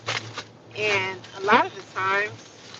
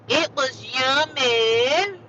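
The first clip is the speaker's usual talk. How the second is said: loud, high, stretched-out savoring; hammed-up singsong relish; drawn-out delight; 5 semitones higher, 5 dB louder, much slower than usual. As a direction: draw every word way out, rising at the end. Repeats it, hamming up delight.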